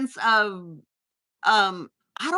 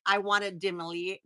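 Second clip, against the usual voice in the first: normal talking voice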